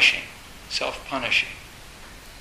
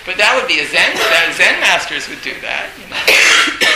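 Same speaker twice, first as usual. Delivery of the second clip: louder, laughing through it; 3 semitones higher, 11 dB louder than usual. Real, not acted. Teacher to students, still amused at the image of Zen masters. Laughing loudly while talking.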